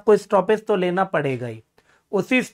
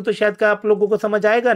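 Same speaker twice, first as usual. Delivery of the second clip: rushed, quicker delivery; fast demo; faster than usual. Shown as a demonstration. Teacher showing students too-fast reading, rushed pace. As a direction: hurry the words to show fast speech. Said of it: "जल्दी जल्दी करके बोलूं".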